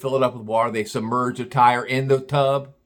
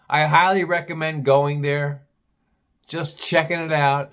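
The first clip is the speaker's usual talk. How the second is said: normal talking voice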